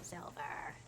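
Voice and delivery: breathy whisper; whispered name. Real, not acted. Mutters the name.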